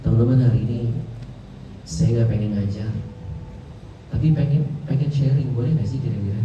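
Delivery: low, gentle, casual sharing tone; family-like intimacy. Shown as a demonstration. Speaker addressing listeners as family, warm and low. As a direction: invite them in softly, like relatives. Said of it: "nganggap teman-teman keluarga, intonasinya beda".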